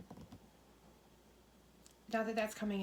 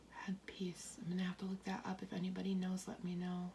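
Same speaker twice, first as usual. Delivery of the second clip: hushed whisper; 7 dB quieter than usual. Real, not acted. Psychic whispering an unclear vision.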